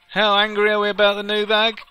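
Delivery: high, loud hype question; mock crowd poll. Gamer hypes viewers about the new bag, high and loud. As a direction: fire up an imaginary crowd.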